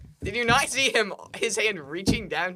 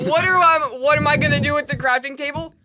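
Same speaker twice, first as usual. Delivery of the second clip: mock outrage; 8 semitones higher, 6 dB louder than usual. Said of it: "say it again".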